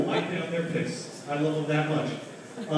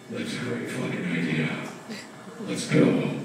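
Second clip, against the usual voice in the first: much lower, gravelly-free deep Batman growl; drunk hero voice; 7 semitones lower than usual. Retold or imitated. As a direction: go low like Batman deciding. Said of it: "when you hear him say it".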